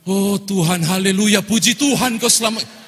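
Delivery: high, loud thankful cry; loud relieved praise. Retold or imitated. Pastor kneels and loudly praises God in relief.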